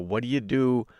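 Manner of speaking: normal talking voice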